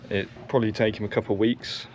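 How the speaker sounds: ordinary talk, nothing marked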